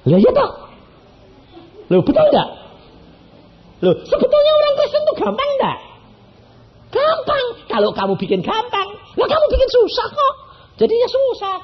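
very high, loud, teasing pulpit exhortation; high-pitched preacher's rebuke; emphatic preaching. Acted. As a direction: challenge the listeners with a laugh.

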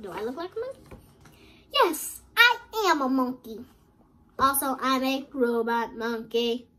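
usual speaking voice

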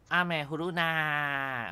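阿美呼噜娜 said very slowly, the last syllable stretched long and pitched high; drawn-out call. Shown as a demonstration. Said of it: "那个长音是拉长拉高嘛".

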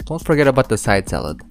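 ordinary talk, nothing marked

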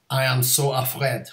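put-on French accent; deliberately hammy French accent. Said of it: "doing a French accent".

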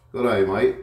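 loud, high mock Australian "Good day, mate"; hammed-up Australian twang on "mate"; playful Australian accent greeting the pet; mock Australian again.

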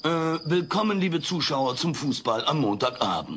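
mock TV sportscaster. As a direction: pretend to be the TV broadcast. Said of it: "das ist bloß der Fernseher". (gespielter Fernseh-Sportreporter)

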